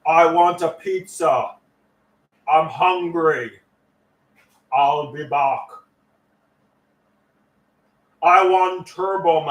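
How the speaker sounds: put-on slow, light Austrian accent; a hint of Arnold, drawn out; Arnold Schwarzenegger impression. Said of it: "Arnold Schwarzenegger impression".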